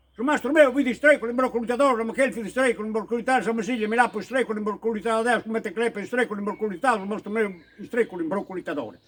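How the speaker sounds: a rapid Sardinian tongue-twister; fast dialect tongue twister trailing off. Shown as a demonstration. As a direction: rattle it off fast, softening toward the end.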